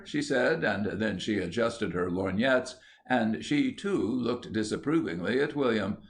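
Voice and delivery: ordinary delivery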